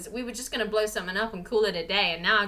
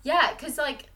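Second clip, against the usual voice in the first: usual speaking voice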